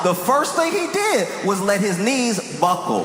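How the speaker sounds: usual speaking voice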